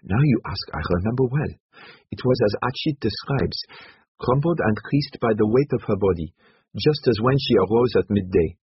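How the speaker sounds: excited witness recalling precise details; Ormoran, excited. Acted. Ormoran's voice in the story.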